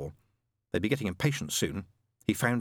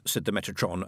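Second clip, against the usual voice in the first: ordinary delivery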